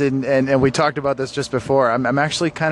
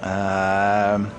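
usual speaking voice

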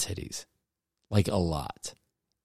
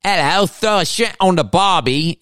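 high, loud, hammed-up Australian accent; bad Australian accent; 9 semitones higher, 4 dB louder than usual. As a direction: put on a terrible Aussie accent. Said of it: "what an Australian accent was".

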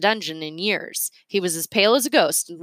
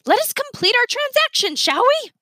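much higher, commanding; imperious Miss Sparks; 4 dB louder than usual. A narrator's reading. Miss Sparks pushing Yancey to close the deal, high and imperious. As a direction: insist with false politeness.

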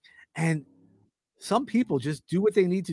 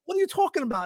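much higher, fast incredulous question; mock indignation; 14 semitones higher, faster than usual. Close friend reacts to being called a nickname, mock indignant. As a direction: fire it back in high-pitched disbelief. Acted touchy reply to a nickname.

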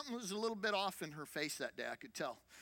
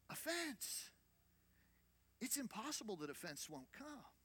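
ordinary delivery

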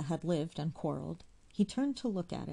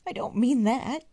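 hasty; 8 semitones higher, 10 dB louder than usual. A narrator's reading. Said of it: "she said hastily".

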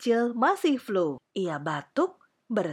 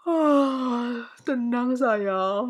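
sleepy queen talking through a yawn; 3 semitones higher, much slower than usual. A narrator's reading. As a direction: yawn the words out, drawn long. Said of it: "jawab Ratu sambil menguap".

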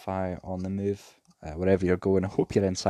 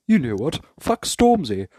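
higher and louder, a hammed-up blurt; mock outburst; 4 semitones higher, 6 dB louder than usual. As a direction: bark it like a fed-up prime minister. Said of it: "turns around in Parliament and goes".

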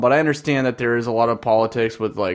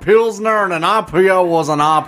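put-on higher, louder grumpy old-timer; old man voice; 9 semitones higher, 5 dB louder than usual. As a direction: rant like an old man about the good old days. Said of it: "this is where I should do the old man voice".

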